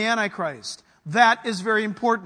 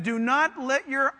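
ordinary talk

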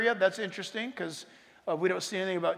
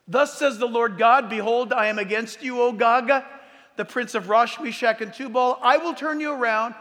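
loud, raised proclamation of a Bible passage; preacher declaiming scripture; scripture reading; 3 semitones higher, 4 dB louder than usual. A narrator's reading. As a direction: read the prophecy out forcefully to the room. Reads scripture aloud to congregation.

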